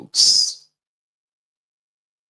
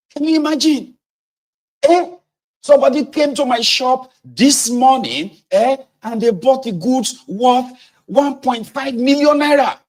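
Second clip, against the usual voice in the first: much higher, loud and flustered rant; angry trader; 12 semitones higher, 5 dB louder than usual. Acted. A cheated trader yelling at the interviewer, furious. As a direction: sputter it out in fury. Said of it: "I said".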